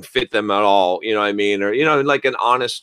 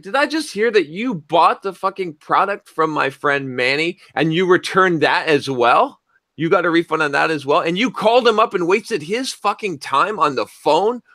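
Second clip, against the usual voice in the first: higher, heated, fast-firing accusations; furious rant; 7 semitones higher than usual. Retold or imitated. Confronting the refund scammer, angry yelling. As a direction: let the anger spill over. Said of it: "I was pissed".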